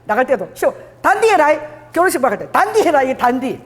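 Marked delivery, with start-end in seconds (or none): 0.5-1.5 higher-pitched Gyeongsang parent's admonition; lightly acted Gyeongsang reminder; Gyeongsang dialect. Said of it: "그래서 경상도로". 2.5-3.0 higher-pitched Gyeongsang parent's admonition; lightly acted Gyeongsang reminder; Gyeongsang dialect. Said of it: "그래서 경상도로".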